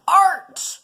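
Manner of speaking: high, loud cry of Art!; outburst. Acted. Son defending his games, a high loud cry. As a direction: shout it like a proud declaration. Proud exclamation.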